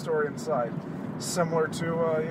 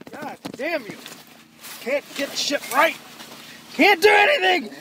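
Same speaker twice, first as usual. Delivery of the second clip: rising higher, exasperated; frustrated cursing; 9 semitones higher, 4 dB louder than usual. Real, not acted. Host wrestling his coat, cursing in exasperation. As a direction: curse a stuck coat, climbing in pitch.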